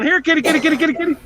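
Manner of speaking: mock calling a cat. Dad calling cat from roof.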